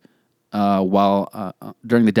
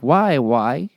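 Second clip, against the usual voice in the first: put-on little girl voice; 4 semitones higher than usual. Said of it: "Can't pronounce her R's".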